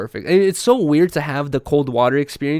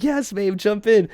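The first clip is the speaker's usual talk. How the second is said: higher-pitched retold encouragement; cheering on; 7 semitones higher, slower than usual. Boyfriend backing the local to Riley, cheering on. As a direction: playfully egg your partner on.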